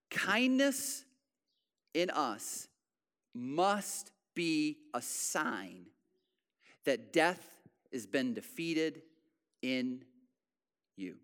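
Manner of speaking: very slow and deliberate, dropping soft; preacher's slow earnest point; slow, solemn emphasis. Real, not acted. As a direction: let each word land, weighty. Solemn main point of sermon.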